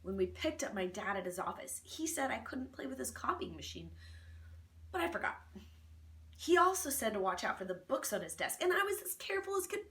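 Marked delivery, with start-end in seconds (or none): none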